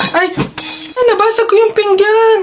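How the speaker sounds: high startled exclamation; shocked cry. Acted. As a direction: cry out at the broken plate. Breaks the plate.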